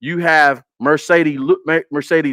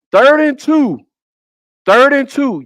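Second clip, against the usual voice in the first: higher and louder, hammering the down and distance; emphatic third down call; 8 semitones higher, 4 dB louder than usual. Real, not acted. Reviewing game film for viewers, a loud emphatic call. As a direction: drive home how short the yardage is.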